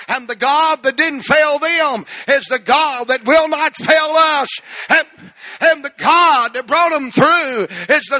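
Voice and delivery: loud, high revival shouting; rally the congregation at full volume; full-force preaching. Real, not acted.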